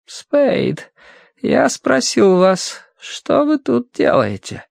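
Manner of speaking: high, slow, wan character voice; thin, listless, nagging. A narrator's reading. Financier demands an answer from Matthew, thin listless nagging. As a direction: press the question in a thin, high drawl. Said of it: "Голос был тощий и вялый".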